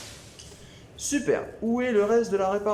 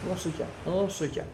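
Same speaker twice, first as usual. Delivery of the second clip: usual speaking voice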